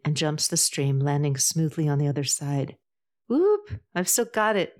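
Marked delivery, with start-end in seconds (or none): 3.3-4.8 high-pitched whoop, narrated not truly yelled; mild triumphant yell. A narrator's reading. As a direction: crow about it in a higher voice, barely louder. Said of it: "he yells loudly into the quiet forest".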